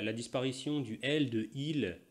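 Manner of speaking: normal talking voice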